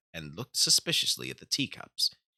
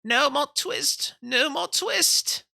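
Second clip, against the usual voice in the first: high, louder, slow delirious moan; feverish tailor; 6 dB louder, slower than usual. Acted. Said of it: "in his dreams he mumbled".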